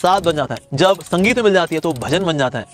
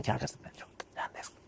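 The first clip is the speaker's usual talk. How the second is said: hushed whisper to a friend; soft unvoiced whisper, calming; 4 semitones lower, 22 dB quieter, faster than usual. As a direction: whisper it, letting it go. Devotee calms his angry friend.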